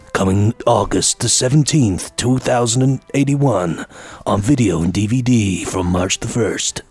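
deep, booming movie trailer announcer; low dramatic trailer voiceover. Shown as a demonstration. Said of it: "do it in the trailer voice".

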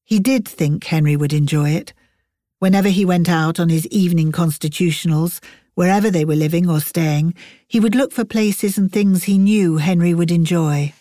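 ordinary delivery

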